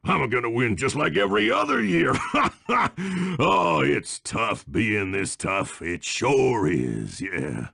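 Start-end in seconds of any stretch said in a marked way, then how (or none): 2.2-3.3 high, cocky belly laugh; villain's self-satisfied cackle; gloating laugh. Acted. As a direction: laugh off the competition. Gloating over a sure win.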